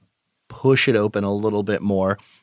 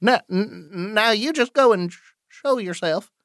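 stammering, high-pitched wary demand; nervous challenge; 9 semitones higher than usual. Acted. Wary challenge to unseen rustling.

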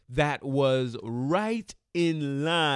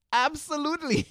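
excited; 11 semitones higher than usual. Acted. Emphatic answer to own question.

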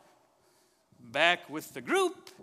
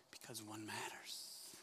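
whispered, soft and slow; a hushed, slow whisper; 8 semitones lower, 20 dB quieter, slower than usual. Real, not acted. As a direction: lean in and breathe the point. Hushed key point of sermon.

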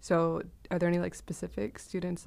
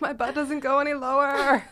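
loud, high mock complaint; whining student; 8 semitones higher, 7 dB louder than usual. Friend playing student begging off twerking, mock whine. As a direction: protest like a suffering class member.

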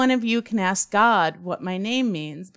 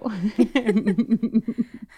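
embarrassed laugh. Real, not acted. Laughs at own mistake.

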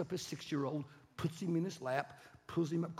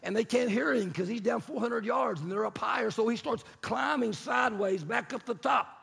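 loud, high, driving narration; intense, raised storytelling voice; heated preacher storytelling; 8 semitones higher, 7 dB louder than usual. A narrator's reading. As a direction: preach the climb with mounting fury.

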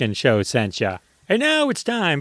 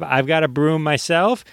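usual speaking voice

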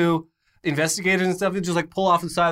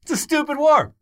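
high, loud indignant refusal; shouting a haughty no; 12 semitones higher, 4 dB louder than usual. Pretend. Voicing France telling off Bush.

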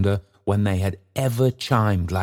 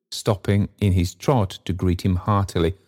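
plain reading voice

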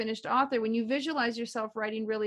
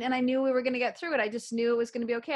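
ordinary delivery

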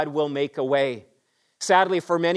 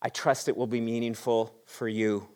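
ordinary delivery

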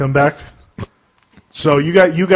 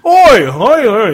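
loud, high hammy Cockney oi; bad Cockney accent; 8 semitones higher, 7 dB louder, much slower than usual. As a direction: shout it like a bad Cockney chimney sweep. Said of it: "a really bad Cockney accent".

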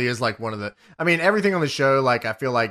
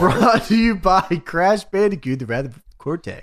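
announcer read; 7 semitones higher than usual. Acted. Cohost reading the show's sponsored segment title, in a mock announcer voice.

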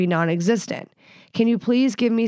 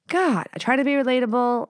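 normal talking voice

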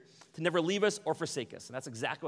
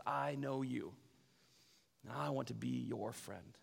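softer and slow, tender invitation; Jesus tenderly inviting Zacchaeus to be his friend, softly; 7 dB quieter, slower than usual. Retold or imitated. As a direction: say it gently like a quiet promise.

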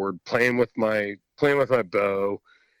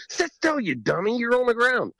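mock dad scolding; 12 semitones higher than usual. Dad gesturing from his tree stand.

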